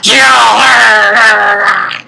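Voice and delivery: drawn-out yowling mock shout; angry cat. Cat girl sees her enemy, angry yowl. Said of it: "I'm an angry cat".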